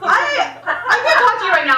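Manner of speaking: high, loud flustered retort. Retold or imitated. Dancer hurrying away from her friend, flustered. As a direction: blurt it out in a high panic. Panicked dancer breaking off the talk.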